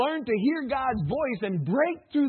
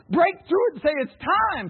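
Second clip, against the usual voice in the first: louder and higher, a rousing call; preaching shout; 6 semitones higher, 5 dB louder than usual. Real, not acted. Preacher calling out to the church. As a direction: pastor rousing the church to say it's time.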